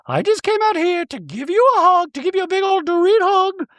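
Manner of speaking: high, prim voice for Dorit; Dorit impression. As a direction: play Dorit sweetly apologetic in a high voice. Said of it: "And Dorit goes".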